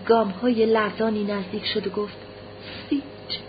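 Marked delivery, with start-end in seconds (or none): 2.9-3.4 barely voiced, whispered calling of a name; hushed name. A narrator's reading. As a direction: whisper it as you approach your child. Mother approaches son with trembling steps. (نجواگونه و بسیار آهسته)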